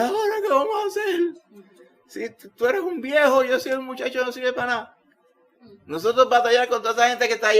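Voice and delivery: put-on high, frantic voice of a frightened servant boy; panicked young servant. Servant Giesi panics before the enemy army, high and frantic. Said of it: "Y Giesi dijo".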